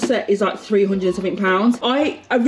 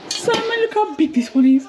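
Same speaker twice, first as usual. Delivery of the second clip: excited; 11 semitones higher than usual. Real, not acted. Thrilled with pots.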